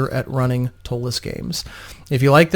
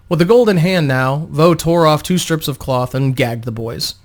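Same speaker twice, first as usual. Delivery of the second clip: ordinary talk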